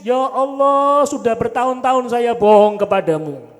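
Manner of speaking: seruan keras tersedu, lambat di awal; perampok menangis. Retold or imitated. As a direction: meratap tobat kepada Allah. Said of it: "Perampoknya nangis". (perampok menangis keras bertobat kepada Allah)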